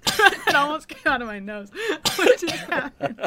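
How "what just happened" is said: normal talking voice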